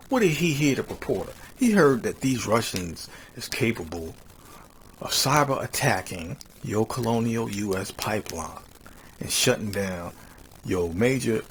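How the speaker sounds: loud, full-force pulpit delivery; preaching. Acted. Host preaching Jeremiah to his listeners, loud pulpit style. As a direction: proclaim the scripture to a congregation.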